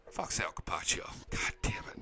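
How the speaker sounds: low, half-whispered cursing; secretive aside. Pretend. Host slips in a dig nobody should repeat, secretive aside. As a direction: curse under your breath. Muttered forbidden remark, kept secret.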